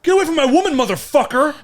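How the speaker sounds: loud, high fighting yell; hammed-up furious shout; mock jealous shout. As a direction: yell it like starting a bar fight. Acts out a bar fight.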